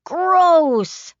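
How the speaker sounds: put-on high, loud little-boy shout of disgust; disgusted outburst. A narrator's reading. Flick recoiling from his sister, loud disgusted boy shout. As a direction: blurt it out, grossed out. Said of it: "Flick burst out".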